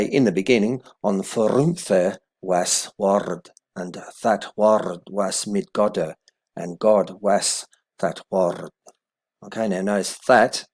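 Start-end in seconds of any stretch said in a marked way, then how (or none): 0.0-8.7 put-on slow, careful Old English recitation; Old English reading. Shown as a demonstration. Teacher reading verse one aloud in Old English. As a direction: read each Old English word out slowly. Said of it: "to say".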